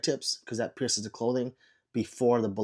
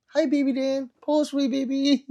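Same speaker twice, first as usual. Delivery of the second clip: mock flirty; 12 semitones higher than usual. Flirty reply to a chat viewer.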